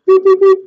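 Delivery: rapid high mouth beeps; imitated beeps. Shown as a demonstration. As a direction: mimic the pulses, punchy. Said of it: "I can't even do it with my mouth".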